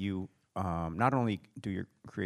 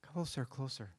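suspenseful hush; 4 semitones higher, 9 dB quieter than usual. Shown as a demonstration. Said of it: "He comes closer and closer".

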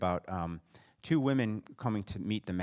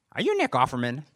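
excited fan; 4 semitones higher, 8 dB louder than usual. Retold or imitated. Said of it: "Closer, closer".

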